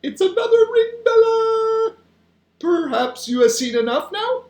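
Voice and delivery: high, loud, outlandish giant voice; big friendly giant. A narrator's reading. The giant asking Sophie about the dreams, playful.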